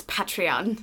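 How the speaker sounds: "Patreon" said the British way; British pronunciation. Shown as a demonstration. Correcting the co-host for British people, British pronunciation of "Patreon". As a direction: put a British accent on "Patreon". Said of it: "For the British people, it's".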